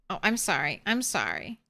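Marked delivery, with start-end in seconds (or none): none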